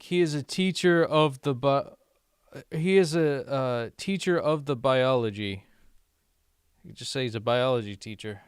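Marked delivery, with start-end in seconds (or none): 0.0-5.6 higher, louder mock-stilted example; mildly exasperated mock example; mock wordy phrasing. Shown as a demonstration. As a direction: lay out the clumsy phrase with exaggerated emphasis. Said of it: "Like, instead of saying".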